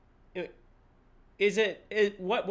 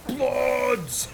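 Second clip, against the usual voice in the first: movie villain line; 6 semitones higher, 4 dB louder than usual. Retold or imitated. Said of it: "he turns around and goes".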